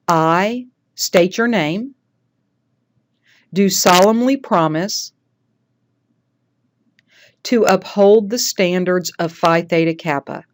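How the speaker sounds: measured ceremonial read-aloud with long pauses; leading an oath. Shown as a demonstration. Teacher leading new members through their oath, measured ceremonial delivery. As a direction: give each phrase out clearly for repeating. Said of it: "I'm gonna lead you through this".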